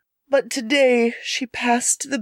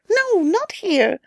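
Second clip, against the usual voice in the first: high, slow coy refusal; lightly played teasing girl; 9 semitones higher, slower than usual. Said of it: "said the girl".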